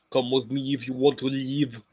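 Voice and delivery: measured Austrian movie-hero impression; Arnold Schwarzenegger. Streamer quoting a movie line, measured Arnold impression. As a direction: deliver it evenly in a mock Austrian accent. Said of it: "trying to sound like Arnold Schwarzenegger".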